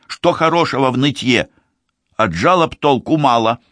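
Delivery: put-on high, sharp scolding girl's voice; curt young woman, pitched high; sharp woman's voice. A narrator's reading. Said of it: "не скрывая резкости тона".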